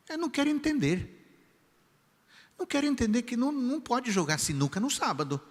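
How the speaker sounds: put-on high, indignant older lady complaining about the boy; stern church lady. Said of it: "a senhora disse assim".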